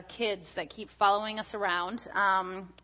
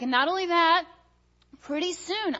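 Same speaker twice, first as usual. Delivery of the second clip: put-on excited little boy; 9 semitones higher, 5 dB louder than usual. Son voiced in story.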